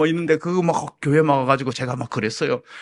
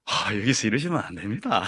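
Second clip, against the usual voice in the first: softer, lower, gently restrained objection; mature polite refusal; 6 semitones lower, 6 dB quieter than usual. Retold or imitated. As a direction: turn them away mildly. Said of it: "그렇게 했으면 참 성숙됐을 텐데요". (점잖고 부드럽게 타이르듯)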